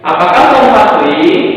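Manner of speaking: "Apakah kamu Fahri?" lifted high as a question, falling on the end; question intonation. Shown as a demonstration. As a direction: show the class how a question sounds. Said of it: "menggunakan intonasi saat melafalkan kalimat tanya".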